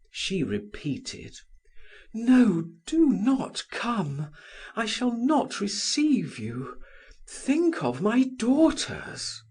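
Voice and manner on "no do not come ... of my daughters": high, anxious pleading refusal. A narrator's reading. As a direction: beg him to stay away. She begs him not to come.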